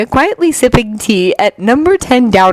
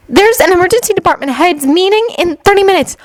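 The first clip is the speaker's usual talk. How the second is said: clerk voice; 11 semitones higher, faster than usual. A narrator's reading. Clerk voice again.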